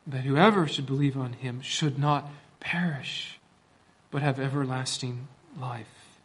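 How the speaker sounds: soft whispered scripture; whispering. Acted. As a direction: hush it like a secret.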